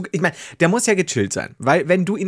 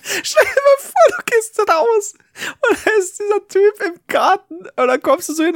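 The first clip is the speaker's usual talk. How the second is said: laughing; 14 semitones higher than usual. Real, not acted. Imagining a stranger who acts like a cat.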